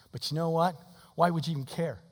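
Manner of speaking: ordinary talk, nothing marked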